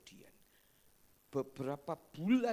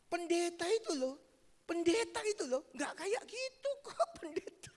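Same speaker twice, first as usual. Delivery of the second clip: high, pleading voice of a family member; family member pleads with father, high and urgent; 11 semitones higher, 4 dB louder than usual. Retold or imitated. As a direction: beg father to reconsider, pitched high. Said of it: "Keluarganya ada yang ngomong".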